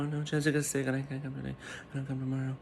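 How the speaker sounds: quick, quiet mock parroting; mocking Kate again. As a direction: rattle the excuse off under your breath. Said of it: "then kept saying".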